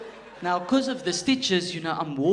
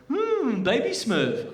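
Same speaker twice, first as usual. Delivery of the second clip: amused doctor; 9 semitones higher, 5 dB louder, much slower than usual. Retold or imitated. Doctor joking during exam.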